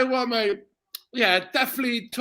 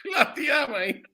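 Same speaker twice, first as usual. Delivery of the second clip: usual speaking voice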